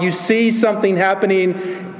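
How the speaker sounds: ordinary talk, nothing marked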